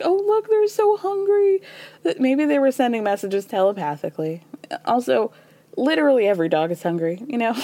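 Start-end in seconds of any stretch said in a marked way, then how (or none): 0.0-1.6 much higher, cooing over dogs; imitating a doting dog lover; mock doting voice. Host voicing Lisa cooing at hungry dogs. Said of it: "but she's like".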